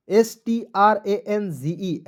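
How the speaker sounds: slow spelling. Shown as a demonstration. Teacher spells out the word.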